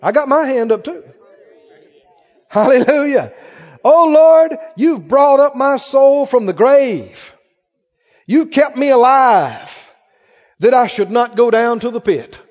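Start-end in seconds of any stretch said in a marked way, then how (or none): 2.5-3.3 loud, ringing hallelujah; shouted praise. Real, not acted. Preacher rejoicing with the congregation, loud. 3.8-12.4 loud, slow, higher fervent proclamation; full-force preaching. Acted. Preacher proclaiming the psalm to the church, loud and slow.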